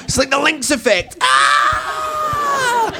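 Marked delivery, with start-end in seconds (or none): 1.2-2.9 high excited squeal; delighted squeal. Retold or imitated. Drunk woman spotting a man with chips, high squeal. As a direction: shriek at the sight of chips.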